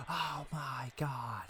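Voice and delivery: slow, breath-only mock shout; hushed excitement to spare a failing voice; whispered exclamation. Shown as a demonstration. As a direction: exclaim it with no voice at all. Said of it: "I can't be like".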